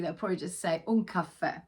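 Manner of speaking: ordinary delivery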